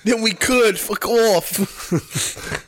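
high-pitched mock comeback; amused, hammed-up retort; imitated angry retort. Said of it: "And he goes".

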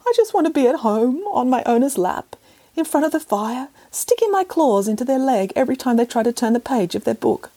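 high, tearful cat voice; a cat close to tears, whining; sobbing cat. Acted. Cat to Nanny Piggins about home, high and tearful. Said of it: "sobbed the cat".

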